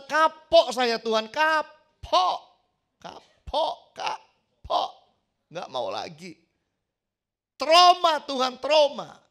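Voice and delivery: loud, high wail of someone who gives up; shouted, drawn-out protest of a worn-out do-gooder; mock fed-up complainer. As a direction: ham up a hurt believer crying out to God. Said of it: "Jangan berkata".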